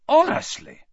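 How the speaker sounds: loud high exasperated. Acted. Ron exasperated at Hermione, loud high exclamation. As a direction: throw it up in despair.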